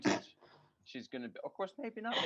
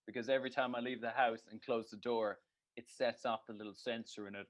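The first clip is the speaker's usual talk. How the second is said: ordinary talk, nothing marked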